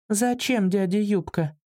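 put-on high-pitched puzzled child; surprised little girl. A narrator's reading. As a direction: wonder aloud in a small child's voice. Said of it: "удивилась малышка".

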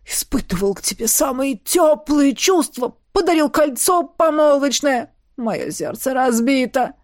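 hammed-up indignant lament; wounded baron. A narrator's reading. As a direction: lament like a betrayed lover. Betrayed baron laments to the maid.

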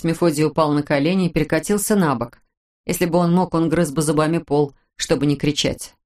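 ordinary delivery

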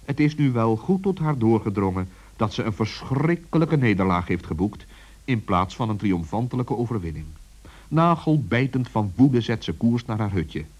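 ordinary delivery, nothing marked

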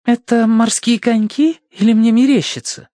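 high-pitched puzzled female character; puzzled woman. A narrator's reading. Verity peering at the ornate sculpture, puzzled.

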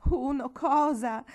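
high, loud, slow "Uno cosa?"; passionate question. Acted. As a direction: she can't bear not knowing any longer. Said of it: "chiese Marigold con passione".

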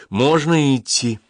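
pushy question. A narrator's reading. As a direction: press for permission. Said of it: "напирал Андер".

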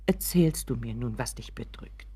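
low, hushed question; tentative whisper. Acted. Mother cautiously asks Richard again, hushed whisper. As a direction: ask in a timid whisper.

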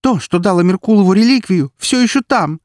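high, loud, urgent insistence; agitated, high-pitched protest; heated argument. A narrator's reading. Heated quarrel, she defends herself.

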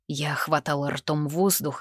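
usual speaking voice

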